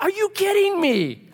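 high, loud, incredulous outburst; hammed-up indignant disbelief; exasperated God. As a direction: rant in mock outrage, pitch way up. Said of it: "And so God's like".

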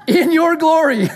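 high, loud, hammed-up grandeur; mock grandiose. Disciples asking for seats in glory, mock grandiose. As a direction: proclaim it grandly, breaking into a laugh.

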